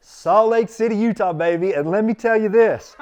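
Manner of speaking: loud and high, bursting with glee; excited. Real, not acted. Answering his host, loud excited glee. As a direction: shout it out proudly with a laugh.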